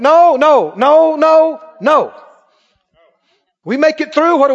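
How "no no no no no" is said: loud, high string of no's; preacher's raised, insistent no; emphatic refusal. Real, not acted. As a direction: shoot it down forcefully. Emphatically rejecting the credit given.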